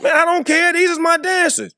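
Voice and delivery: loud, very high defensive outburst; hammed-up indignant pushback; mock protest. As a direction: protest loudly, pitch shooting up. Retold argument with tattling friend.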